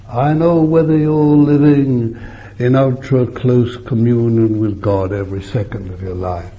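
ordinary talk

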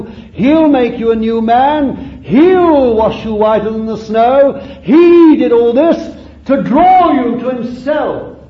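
high, loud gospel proclamation; impassioned preaching. Real, not acted. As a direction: build to a ringing pulpit cry. Preaches at full force.

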